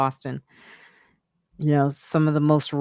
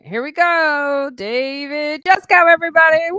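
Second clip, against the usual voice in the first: announcer intro; 12 semitones higher, 6 dB louder than usual. Acted. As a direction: bring the guest on like a host.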